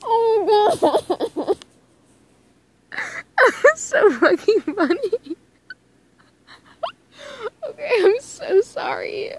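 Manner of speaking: high, wheezing real laughter through the words; can barely get the words out for laughing; real giggling apology; laughing hard. Real, not acted. Reader cracking up mid-reading.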